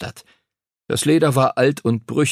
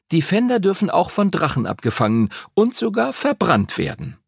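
high-pitched schoolboy reciting rules; eager know-it-all pupil; 9 semitones higher than usual. Acted. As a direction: lecture the class in a raised, eager pupil's voice. Arthur explains the game in class.

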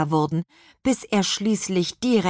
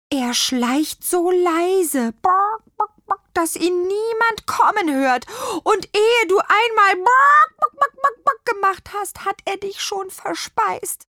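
cooing; 4 dB louder than usual. A narrator's reading. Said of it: "gurrte Tarantula leise".

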